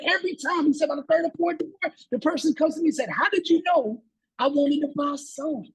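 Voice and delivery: high, thrilled retelling; excited storyteller. Retold or imitated. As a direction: tell it bursting with excitement. Salesman fired up, high pitch.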